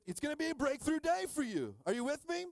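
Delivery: high, loud preacher build-up; excited hype. Real, not acted. Preacher rallying the church, excited and high. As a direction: pump up the congregation.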